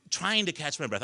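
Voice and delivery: ordinary talk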